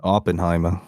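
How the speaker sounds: put-on Oppenheimer in a JFK Boston accent. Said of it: "hear him say Oppenheimer in his Boston accent".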